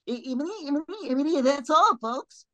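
high stuttering cartoon pig. Cartoon voice medley.

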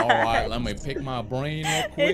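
hammed-up Southern accent. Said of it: "in your southern voice".

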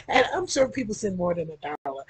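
ordinary talk

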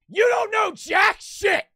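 high, loud furious yell, slow and pointed; imitating angry professor. Professor snapping at the student, high loud mock yell. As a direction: throw it back at him like an outraged teacher. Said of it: "my professor gets so mad".

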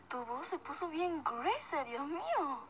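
high, laughing voice; amused exclamation. Real, not acted. Reacting to a friend's changed voice, laughing and high. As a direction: laugh through it in amazement.

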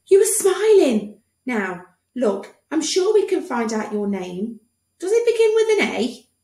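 high, eager doctor voice; cheery doctor. Acted. Doctor quizzing the boy, high cheery voice. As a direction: coax the boy along brightly.

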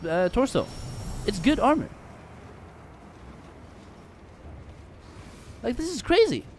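high indignant ranting; outraged disbelief. Real, not acted. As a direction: whine it in outrage. Outraged complaint about armor.

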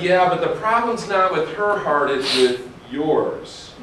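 slow, gentle God's correction; God's rebuke. Retold or imitated. God gently telling the child the fault is theirs, slow. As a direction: land the last words slowly. God answering sister prayer.

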